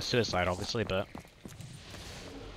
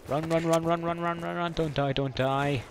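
being chased; 6 semitones higher than usual. Real, not acted. Running from zombies in game.

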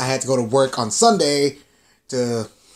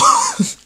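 squeaky laugh; 3 dB louder than usual. Real, not acted. Laugh after insult.

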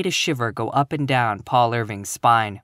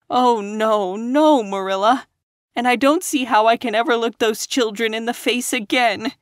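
put-on higher, ashamed girl near tears; tearful; 8 semitones higher than usual. A narrator's reading. Ashamed young teacher to Marilla, tearful. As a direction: lament it through a catching voice. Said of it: "When Anne's sobs grew quieter".

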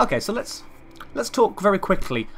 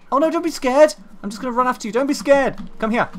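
cooing to an animal; 10 semitones higher, 8 dB louder than usual. Real, not acted. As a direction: coax it like a pet. Coaxing a small animal.

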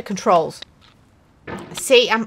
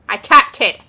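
usual speaking voice

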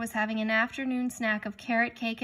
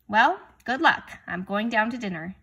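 louder, brighter goodbye; cheerful father; 2 semitones higher, 8 dB louder than usual. A narrator's reading. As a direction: cheer him on and leave.

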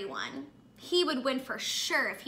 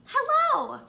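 excited greeting; 10 semitones higher, 6 dB louder than usual. Acted. Greeting the children.

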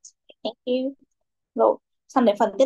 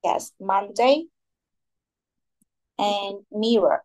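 slow confirmation; much slower than usual. Shown as a demonstration. Teacher confirms answer slowly.

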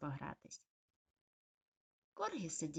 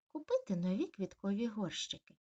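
usual speaking voice

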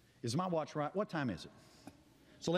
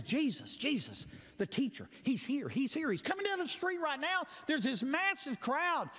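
rising higher, eager news; excited bystander; 8 semitones higher than usual. Retold or imitated. Bystander telling Bartimaeus Jesus is coming, excited and higher. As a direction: tell him Jesus is here, breathless. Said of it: "somebody says".

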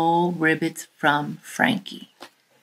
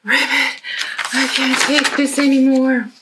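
fed-up frog; 6 semitones higher, 4 dB louder than usual. Acted. Frank in the back is like.

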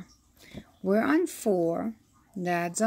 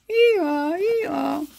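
donkey bray; 7 semitones higher than usual. A narrator's reading. Reading a picture book, a playful high donkey bray. Animal noises in the bank.

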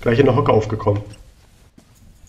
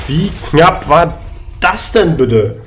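slow amazed drawl; 4 semitones higher, 3 dB louder, much slower than usual. Real, not acted. Narrow escape in game.

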